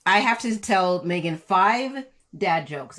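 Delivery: ordinary talk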